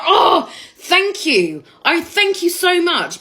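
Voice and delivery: high, drawn-out gushing thanks; hammed-up grateful gush; effusive gratitude. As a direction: thank them with overwhelming relief. Retold gushing thanks to admirer.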